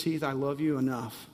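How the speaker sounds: soft, low words of God, fading to a whisper; gentle voice of God trailing into a whisper; God's tender voice. Acted. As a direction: speak as a loving God, very softly. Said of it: "that he says".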